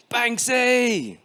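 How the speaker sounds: high, ringing shout of a name; excited call. Acted. Boy shouting to Gordon Banks, high and thrilled. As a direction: call out to your hero on the pitch. Boy calling to his hero.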